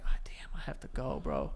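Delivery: low, whispery inner voice; hushed aside, retelling a moment of panic; retold desperate thought. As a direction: mutter it softly to yourself under strain. Retelling his own urgent thought.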